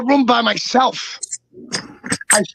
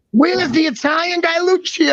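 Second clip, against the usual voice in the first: high, sing-out imitation of someone searching; searching brother calling out; 6 semitones higher than usual. Brother roams hotel lobbies seeking Lucio, mock calling out. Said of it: "walking up and down ... going".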